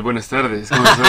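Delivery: low, seductive greeting; low mock-sexy greeting; mock sensual voice. Shown as a demonstration. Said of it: "puedo hablar así".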